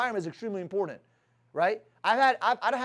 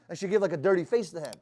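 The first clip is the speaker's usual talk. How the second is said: normal talking voice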